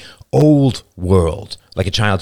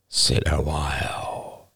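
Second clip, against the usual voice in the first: slow, low, soft breathy invitation; breathed; 4 semitones lower, 5 dB quieter, much slower than usual. A narrator's reading. Younger boy holding the girls close, breathed softly. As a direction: breathe it out slowly, low and hushed. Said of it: "he breathed".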